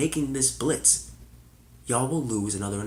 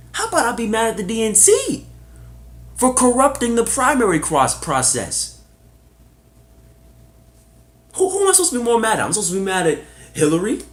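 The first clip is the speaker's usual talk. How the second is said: higher, louder, fired-up protest; heated outrage; 9 semitones higher, 6 dB louder than usual. Real, not acted. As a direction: demand it, indignant and fast at the end. Heated rant about the DNC.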